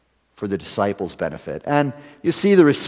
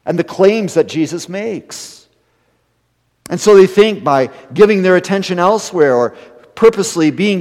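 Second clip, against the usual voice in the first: louder, higher pulpit fervour; impassioned preaching; 5 semitones higher, 7 dB louder than usual. Acted. Pastor confronting congregation about avoiding Jesus, impassioned preaching. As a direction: press the point hard from the pulpit.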